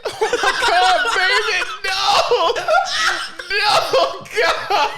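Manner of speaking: high, loud, laughing lament; mock wailing. Mother despairing over her kid, mock wailing. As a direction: wail it in hammed-up despair.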